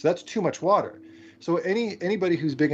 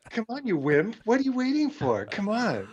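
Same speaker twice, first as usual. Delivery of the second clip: frat bro; 8 semitones higher than usual. Pretend. Siri taunting the drinker.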